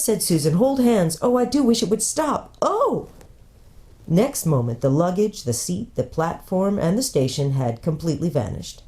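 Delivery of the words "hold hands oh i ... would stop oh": frightened. A narrator's reading. Susan frightened by pulling magic.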